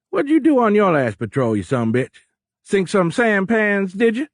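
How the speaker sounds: loud, high Cajun ribbing; high-pitched Cajun captain, loud and needling; thick Cajun accent. A narrator's reading. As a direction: taunt a fellow captain in broad Cajun. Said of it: "in a thick Cajun accent".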